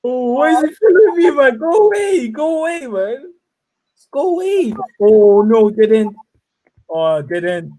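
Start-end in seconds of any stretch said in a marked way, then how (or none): 0.0-4.7 high frantic pleading; shouting at a chaser in panic; panicking gamer. Real, not acted. Chased by another player. 5.0-6.2 loud dismay. Real, not acted. Caught by chaser.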